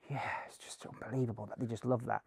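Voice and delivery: hushed, near-whispered imitation; imitated quiet reply. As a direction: murmur it back under the breath. Voices the actor's weary reply.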